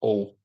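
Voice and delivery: "Hull" softly, with the H left off; dropped H. Shown as a demonstration. Demonstrating the Hull accent to viewers, dropped H. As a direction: drop the H of "Hull", said quietly. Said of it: "Drop your H's".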